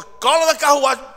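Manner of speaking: loud, high, urgent command; commanding call. Acted. God sends Felipe to the chariot, loud command. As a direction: call out an order from above.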